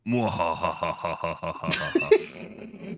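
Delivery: slow, drawn-out evil villain laugh; mad scientist laugh. Acted. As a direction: cackle it like a gleeful overlord. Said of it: "Eamon goes".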